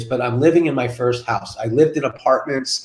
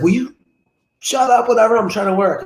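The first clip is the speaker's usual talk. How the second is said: higher-pitched mock exasperation; annoyed wife; 7 semitones higher than usual. Wife interrupted at work tells husband off, mock annoyed. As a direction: imitate a spouse fed up with being disturbed.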